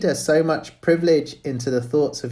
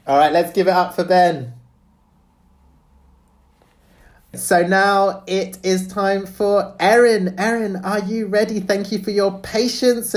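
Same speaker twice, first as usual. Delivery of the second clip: higher-pitched, lifted event-host voice; upbeat host announcement; 8 semitones higher than usual. Acted. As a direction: hand the stage over to the next reader with a lift. Introduces the next reader.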